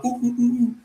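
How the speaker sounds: high hooting "ooh ooh"; mock ape sounds. Mocking a man begging for a banana, with high hooting. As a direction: imitate a monkey hooting. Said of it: "does gorilla sounds".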